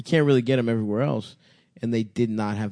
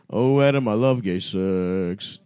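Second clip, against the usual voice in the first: slow, low, husky come-on; raspy sexy voice; 3 semitones lower, slower than usual. Pretend. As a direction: purr it out like a seductress. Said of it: "my raspy, sexy voice".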